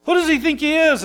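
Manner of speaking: high, mocking indignant crowd; incredulous sneer, pitched high; indignant scoffing. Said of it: "is what they're saying".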